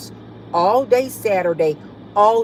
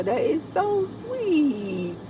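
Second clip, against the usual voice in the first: touched, slow cooing; 9 semitones higher, 4 dB quieter, much slower than usual. Real, not acted. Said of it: "don't make me get emotional".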